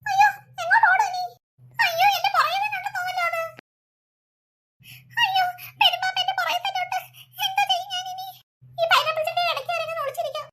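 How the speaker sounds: high, panicked cries of ayyo at a python; boy alone at night spotting a huge python, terrified; scared child. Acted. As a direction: gasp it out as if the snake is right behind you.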